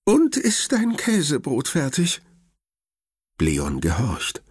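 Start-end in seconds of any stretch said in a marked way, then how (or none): 0.1-2.2 high, lightly voiced worried grandmother; grandma's voice. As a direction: speak up high as the fussing grandma. Grandma still anxious. (hohe, besorgte Oma-Stimme)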